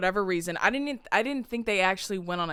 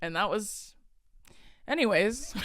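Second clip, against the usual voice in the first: usual speaking voice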